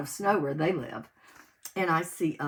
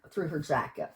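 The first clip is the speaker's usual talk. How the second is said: usual speaking voice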